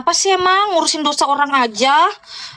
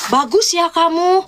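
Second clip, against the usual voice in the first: higher, slow mock sulky reproach; imitating a sulky guy; 6 semitones higher, slower than usual. Retelling the jealous guy's words to viewers, mock sulky reproach. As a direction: mimic his jealous pouting line. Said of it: "bapernya gini ngomongnya".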